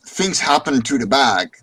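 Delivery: ordinary talk, nothing marked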